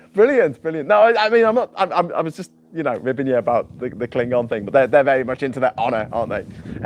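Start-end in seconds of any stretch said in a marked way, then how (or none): none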